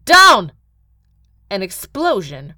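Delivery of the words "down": high, very loud warning shout; yelling. Acted. Gil pulling Agatha to the ground, yelling. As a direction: yell an urgent warning. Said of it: "while yelling".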